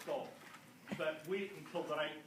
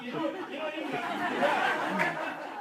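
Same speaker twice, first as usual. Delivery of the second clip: emphatic; 8 semitones higher, 8 dB louder than usual. Real, not acted. Emphatic repeated point.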